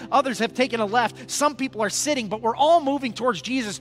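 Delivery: loud, driving sermon exhortation; full-force preaching. Real, not acted. Preacher urging the church on in the race, forceful. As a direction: rouse the congregation to run the race.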